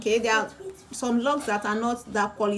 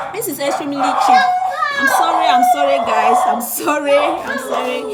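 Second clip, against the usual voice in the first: loud shouted apology over a barking dog; shouting over noise; 4 semitones higher, 8 dB louder than usual. Real, not acted. Seller shouting apologies to livestream viewers over the dog, loud. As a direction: call it out loud so they hear you over the noise. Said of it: "um, for the noise".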